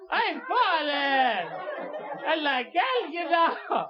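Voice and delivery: put-on slow, high, whining old woman wail; obnoxious old lady. Comic imitating the fallen elderly woman from the commercial, slow high whine. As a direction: drag out every word like a helpless grandma. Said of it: "the most obnoxious voice in the world".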